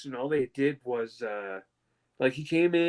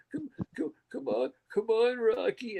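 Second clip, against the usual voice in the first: high, choked voice calling the dog; fighting tears while calling Rocky; retelling breaking down while calling the dog; crying for real; 8 semitones higher than usual. Retold or imitated. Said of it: "they became fucking real".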